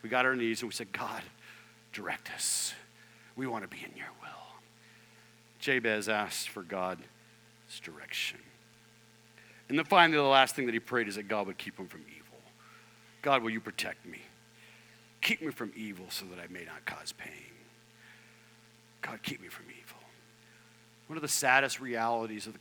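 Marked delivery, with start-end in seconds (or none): 0.9-4.6 softer, lower, whispered plea to God; whispered prayer on their knees asking God for direction; hushed prayer. Retold or imitated. Quoting their humble prayer. 13.2-20.0 softer, lower, hushed plea; whispered prayer. Acted. Voicing Jabez's prayer.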